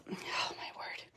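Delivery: breathless whispered sigh of disbelief; whispered exasperation. Real, not acted. Crafter exasperated by her dogs, whispering. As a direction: whisper it to yourself, worn out. Exasperated aside after dog chaos.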